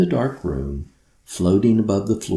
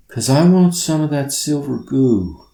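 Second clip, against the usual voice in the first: put-on drowsy girl, words slurring; sleepy slur; 6 semitones higher, 5 dB louder than usual. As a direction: mumble it half asleep. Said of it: "Carly's words slurred with drowsiness".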